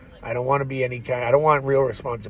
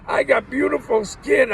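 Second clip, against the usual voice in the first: indignant protest; 12 semitones higher, 5 dB louder than usual. Retold or imitated. Man insists on his skin.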